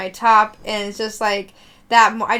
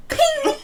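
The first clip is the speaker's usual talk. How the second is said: high, sharp ping noise; ping like a racket hit; high ping sound. Retold or imitated. Girl mimics the racket smacking the cat: high ping.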